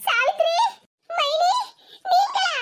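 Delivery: high-pitched, halting disbelief; acted reunion surprise in a high voice; astonished recognition. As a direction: call out in wonder, word by word. Sick woman wakes to unexpected visitor.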